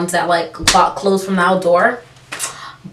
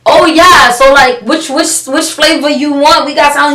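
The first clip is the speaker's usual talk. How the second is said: loud, high mock-helpful offer; sarcastically playing dumb; sarcastic; 5 semitones higher, 6 dB louder than usual. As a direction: feign eager service to dodge the invitation. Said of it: "so I'm really sarcastic".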